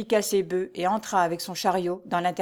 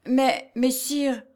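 high, slow, aghast cry; stunned peasant; 6 semitones higher, much slower than usual. A narrator's reading. Stunned peasant crying out to the steward. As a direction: stammer it out, dumbfounded. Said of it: "s'écria le paysan stupéfait et consterné".

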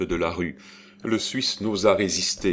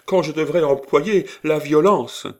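high, loud, outraged threat; furious outcry; 9 semitones higher, 7 dB louder than usual. A narrator's reading. Old lady threatening force to stop the girl, furious outcry. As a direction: cry the threat out vehemently. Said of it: "s'écria madame Desroches".

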